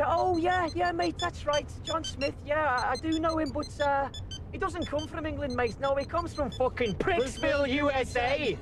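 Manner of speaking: high, overly chummy sarcasm; mock cheery reply. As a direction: play it falsely friendly to mock him. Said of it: "I'm like".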